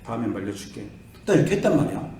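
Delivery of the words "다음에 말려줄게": soft, restrained apology with the anger swallowed; calm it down and say sorry quietly; softly swallowing his temper to answer his son; holding back anger. Retold or imitated. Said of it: "불이 막 여기까지 올라오다가".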